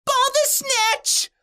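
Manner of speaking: very high, drawn-out scream; stretched falsetto cheer; cheerleader's scream. A narrator's reading. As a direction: squeal the name like a cheering fan. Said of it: "she screamed".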